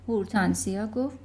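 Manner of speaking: plain reading voice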